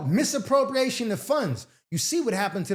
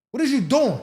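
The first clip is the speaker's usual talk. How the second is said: loud, high incredulous outburst; scolding the wife who ignored him, loud and high; outraged; 5 semitones higher, 6 dB louder than usual. Real, not acted. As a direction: demand an answer in disbelief.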